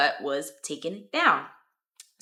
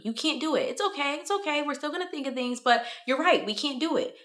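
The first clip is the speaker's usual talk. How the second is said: placating brain voice; 8 semitones higher than usual. Acted. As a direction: pitch it up and keep it gentle, like a soothing inner voice. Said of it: "my brain confirmed that for me".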